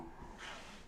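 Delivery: quick unvoiced gasp; sharp gasp. Real, not acted. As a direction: gasp in surprise. Notices a missed corner.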